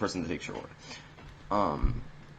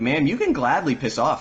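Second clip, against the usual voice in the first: higher, louder retold dismissal; mock manager telling-off; 7 semitones higher, 5 dB louder than usual. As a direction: imitate a manager sending a customer away. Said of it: "she's cussing at her too".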